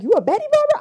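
high, loud teasing question; mock surprise. Friend teasing Barbara about being a baddie, high incredulous squeal. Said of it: "he's like".

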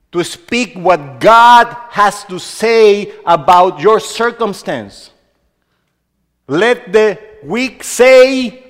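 loud, slow, pounding preacher's cry; preaching at full force. Acted. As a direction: exhort the church, hammering every phrase.

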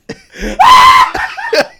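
high, loud burst of laughter. Real, not acted. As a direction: erupt into a big laugh.

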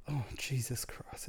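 slow, breathed-out oath under the breath; near-whispered shock; hushed disbelief. Retold or imitated. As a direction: say it slowly and softly, stunned. Retold shock at the confession.

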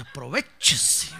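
slow, stretched "aprovechese" with a wink; drawn-out aprovechese. Acted. Preacher slyly advising husbands, slow and stretched. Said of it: "aquí entre nos".

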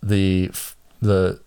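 ordinary talk, nothing marked

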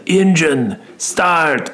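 high, mock Russian accent, badly done; a lame try at a Russian mobster accent; clumsy Russian accent; terrible Russian accent. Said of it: "that's a terrible Russian accent".

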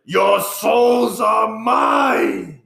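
very slow, high, loud villain roar; Imhotep. Acted. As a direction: bellow it as the mummy, dragging out each word. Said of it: "you have a better Mummy impression".

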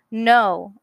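standing up for herself with a loud "No,". Acted. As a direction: push out a firm, loud "No,". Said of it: "I said louder".

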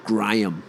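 GRAHAM as "Gry-um"; Y in the middle of Graham; broad two-syllable Gry-um; Aussie pronunciation. Shown as a demonstration. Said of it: "put a Y in the middle".

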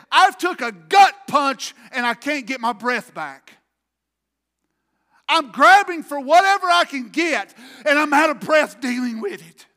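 full-force preacher's shout, pitched high; desperate outcry. Retold or imitated. Preacher acting David out of breath and grabbing, shouted desperation. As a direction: cry it out loud as if gut-punched.

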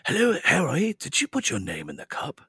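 put-on old wizard reading voice, starting high; Dumbledore. Dad's character voice reading to his kids, calm wizard. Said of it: "that is my Dumbledore when I read it".

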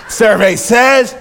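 loud, high, stretched game-show call; game show host. Acted. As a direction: shout it like a quiz host. Mimics game show host's catchphrase.

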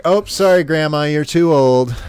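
hammed-up polite refusal; mock apology. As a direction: fake sorry to a grandma. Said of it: "It's like".

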